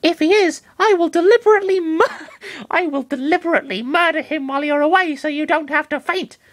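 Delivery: high Pokemon voice with a mock menace and a laugh; a cute, high, half-laughing threat; threatening Lucario. Lucario threatens Master Hand to defend Chris, mock-menacing. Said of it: "in a threatening voice".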